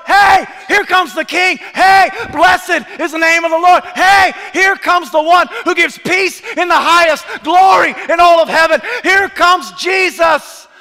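full shout, high and loud; crowd shouting. Retold or imitated. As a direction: shout like a crowd hailing a king. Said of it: "they shouted at the top of their voice".

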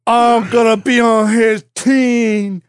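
slow, high, drawn-out fanboy voice; mock eager fan. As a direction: stretch it out high like a smitten supporter. Mock fan picking a war side.

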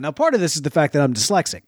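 usual speaking voice

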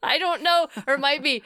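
ordinary talk, nothing marked

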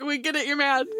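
high, laughing, half-crying; laughing exasperation. Retold or imitated. Answering the cat's endless mad button, laughing. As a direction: crack up while pleading.

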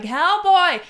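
high, loud exasperated yell; drunken shout. Retold or imitated. Drunk customer arguing with the beer booth guy, shouting. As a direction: yell it as if they must be deaf.

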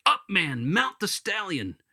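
high, loud call to action; rousing command. Acted. Angel ordering the resurrected man onto the stallion, rousing command.

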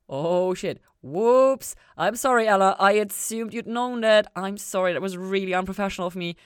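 loud, high flustered apology; loud embarrassed apology. A narrator's reading. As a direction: scramble to take it back. Linda apologizes for slip.

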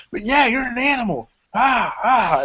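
high, growly pep talk; Ethan Carter hyping. Retold or imitated. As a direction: egg him on like a coach. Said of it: "he's like".

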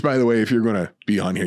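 normal talking voice

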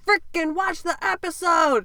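half-yelled impatient demand; exasperated demand. Retold or imitated. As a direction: push them to watch it. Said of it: "then she's like".

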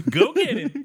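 high, loud, laughing at a dog; laughing pet talk. Pretend. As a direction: cheer the dog on while laughing. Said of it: "I don't actually talk like that to an animal".

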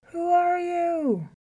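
high, drawn-out, distant-sounding echo; echo from a well. A narrator's reading. Well answers the lion, echoing his holler. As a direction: call back slowly like an echo. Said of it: "voice came echoing back up".

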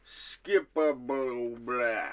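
very slow, stretched-out nonsense cry; recreated odd utterance. Retold or imitated. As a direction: drag each syllable way out. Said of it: "recreating it good enough".

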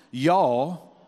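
Southern drawl on Y'all, loud single vowel. Shown as a demonstration. Preacher teaching church to say Y'all. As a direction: say Y'all like a mountain grandmother. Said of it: "That means you all in Southern".

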